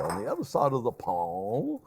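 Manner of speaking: hammed-up highbrow reading of "the other side of the pond"; pompous. Shown as a demonstration. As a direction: put on airs like a stuffy Englishman for a joke. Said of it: "I sound very, uh, pompous".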